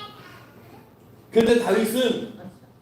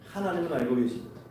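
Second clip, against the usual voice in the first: hushed solemn; 3 semitones lower, 8 dB quieter than usual. Acted. Solemn point about God knowing.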